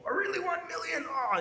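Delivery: high, loud mock amazement; amazed listener. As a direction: gasp at the deal like a wide-eyed newcomer. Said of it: "And people".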